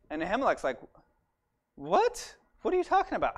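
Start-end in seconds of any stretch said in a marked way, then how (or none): 1.8-3.4 much higher, incredulous what; a priest stunned by the accusation; shocked disbelief. Acted. Priest accused of helping enemies.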